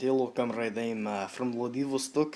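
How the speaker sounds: put-on Russian comrade accent. Student showing off in math class, Russian accent. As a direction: play a proud Soviet comrade in a Russian accent. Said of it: "I do my Russian accent".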